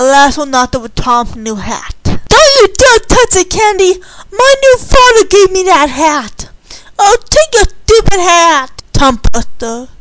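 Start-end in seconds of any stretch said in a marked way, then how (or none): 2.0-6.5 high, loud protective outburst; angry warning. Acted. Tom to Candy over his new father's hat, angry warning. 7.0-8.8 loud, high, drawn-out retort; exasperated retort. Acted. Candy to Tom about his hat, exasperated retort. Quarrel over the new hat.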